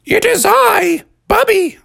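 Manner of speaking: put-on high-pitched kid announcing himself; Bubby. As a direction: pipe it up proudly and high. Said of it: "And Bubby said".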